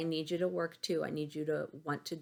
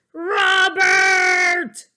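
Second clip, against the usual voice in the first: high, loud furious teacher shout; angry shout; 13 semitones higher, 13 dB louder than usual. Acted. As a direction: yell his name in anger. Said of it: "she says".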